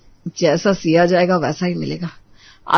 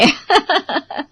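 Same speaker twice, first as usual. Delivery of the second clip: amused chuckle; 7 semitones higher than usual. Real, not acted. Narrator laughs at own joke.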